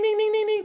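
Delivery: high, loud ringing dings like a crossing bell; imitated crossing bell. Driver stuck at the crossing mimics the bell, high ringing dings. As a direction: ring it out like the railroad signal.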